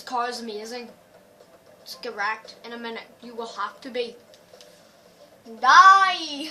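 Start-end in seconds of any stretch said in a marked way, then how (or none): 5.6-6.5 loud gleeful battle cry; playful shout. Real, not acted. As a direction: yell it at the rival cars. Racing game, threatening rival.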